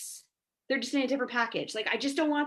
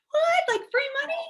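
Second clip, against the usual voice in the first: excited disbelief. Retold or imitated. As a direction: shriek it like free money just fell in your lap. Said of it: "And I thought".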